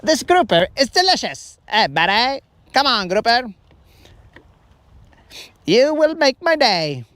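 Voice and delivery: a guy happily talking to his fish; mock Colin. Colin shrugging off rejection, hammed-up goofy voice. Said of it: "Colin was all like".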